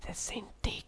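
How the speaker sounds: breathy whispered name; whispering. Real, not acted. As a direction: whisper it aside. Whispered aside.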